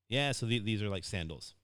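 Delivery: ordinary delivery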